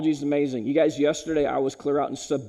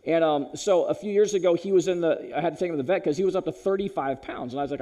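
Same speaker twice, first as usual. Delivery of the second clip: usual speaking voice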